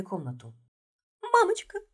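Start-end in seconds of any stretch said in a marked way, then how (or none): 1.2-1.8 high strangled squeak of terror; frightened squeak. A narrator's reading. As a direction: squeak it out, choked with fear. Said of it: "сдавленно пискнула".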